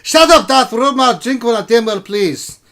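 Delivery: very loud, high dwarf bellow; cranky dwarf king yelling back; defiant dwarf king. Acted. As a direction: shout down the nagging whisperer. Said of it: "Canterbury leans over".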